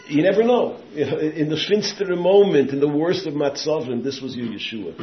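loud, high sermon call; preaching at full force. Acted. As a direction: drive the lesson home to the congregation.

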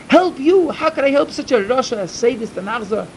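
indignant refusal. Acted. Rebbe refusing to help a sinner, indignant. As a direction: throw the plea back at him, outraged.

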